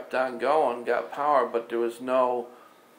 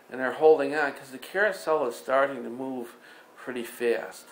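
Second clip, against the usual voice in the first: ordinary talk, nothing marked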